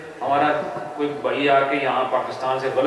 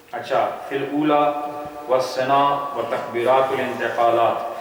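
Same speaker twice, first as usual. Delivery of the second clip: reading text. Acted. Reads Arabic text from book.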